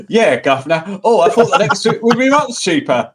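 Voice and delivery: high, loud comic guv'nor; mock guv'nor voice. Joking to co-hosts about British money, mock guv'nor. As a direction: ham it up as a cheeky guv'nor, laughing. Mock Brit after coppers joke.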